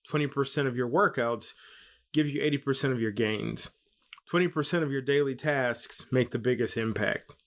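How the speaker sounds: usual speaking voice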